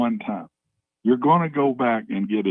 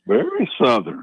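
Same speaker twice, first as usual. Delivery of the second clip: mock Southern accent; 6 semitones higher than usual. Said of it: "It's, like, very, uh, very Southern".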